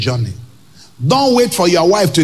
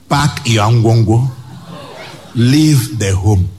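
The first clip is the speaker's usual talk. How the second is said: lower, slow, deliberate command; slow dismissal; 6 semitones lower, slower than usual. Acted. Preacher advising the wronged spouse, slow low dismissal. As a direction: lay each word down flatly.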